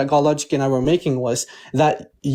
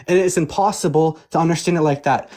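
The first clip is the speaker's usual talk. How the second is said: normal talking voice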